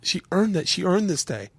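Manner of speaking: high, emotional, voice swooping upward; choking up on the wife's words; real tears threatening, pitch climbing; near tears. Real, not acted. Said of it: "I might actually cry".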